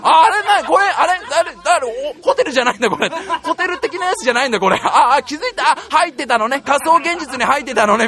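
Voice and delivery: high, loud, laughing "oh, I get it"; excited realisation. Retold or imitated. Realising it's the virtual world, excited and laughing. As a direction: burst out laughing as it clicks.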